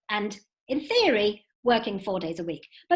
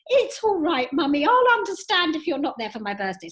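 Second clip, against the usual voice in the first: put-on a lightly raised, gentle little-girl voice; voicing young daughter; 6 semitones higher, 4 dB louder than usual. Daughter on the phone comforting her crying mum, lightly voiced child.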